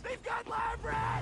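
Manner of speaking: slow, yelled alarm at the crew; alarmed shout. Acted. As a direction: shout it out across the fight. Firefight, crewmate just captured.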